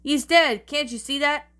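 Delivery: pissed off, loud and high; angry yell. A narrator's reading. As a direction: yell it in exasperation. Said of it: "incredibly pissed off face and yelled".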